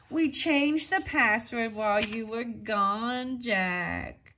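sentry calling out. Acted. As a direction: call out slowly from the dark. Sentry voice across the dark.

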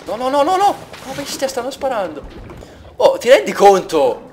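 high panicked cries; alarmed at sudden gunfire; panicked shouting. Real, not acted. Being shot at suddenly.

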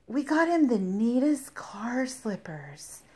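hushed excited whisper. Real, not acted. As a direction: whisper it like a secret. Excited whisper about slippers.

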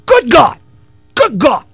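high, loud soul shout of Good God; [name] impression. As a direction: belt it like a funk singer's yelp. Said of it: "as [name] was at going".

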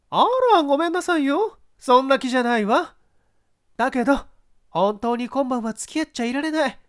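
very high, sweet put-on pleading; coaxing actress. Actress sweetly putting off her ex-husband, very high and coaxing. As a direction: wheedle him off lightly.

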